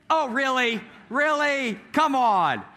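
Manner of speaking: high, mock-outraged disbelief; incredulous dad. Dad mocks son's lie.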